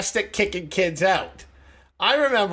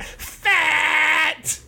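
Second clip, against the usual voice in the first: high shouted kid voice mocking; bratty kid yelling fat; kid's taunt; 10 semitones higher than usual.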